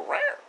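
high-pitched mock "rawr"; imitated creature roar. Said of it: "He heard something go".

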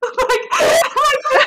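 loud high laugh through the words; high laughing shout. Real, not acted. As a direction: burst out laughing. Both laughing.

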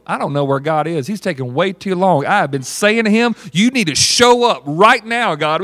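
loud, exasperated complaint to God; harsh complaint. Acted. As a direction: build from grumbling to a forceful demand. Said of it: "David would say some pretty harsh things".

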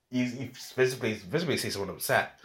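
usual speaking voice

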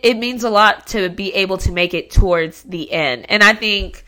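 normal talking voice

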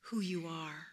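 voiceless, soft trailing off; hushed sermon close; whisper. Real, not acted. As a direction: let it fade to a breath. Fading line in sermon.

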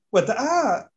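high, loud shocked cry; villain screaming as her spell rebounds; startled scream. A narrator's reading. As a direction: shriek as the attack backfires. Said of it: "Isis screamed".

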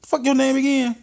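squeaky high contempt; high-pitched dismissive boss; Trump character. Acted. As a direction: yelp it way up, annoyed.

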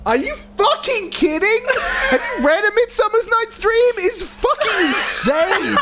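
high, laughing disbelief; real helpless disbelief; incredulous outburst. Real, not acted. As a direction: exclaim it high, breaking into laughter.

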